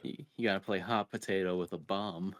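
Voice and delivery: normal talking voice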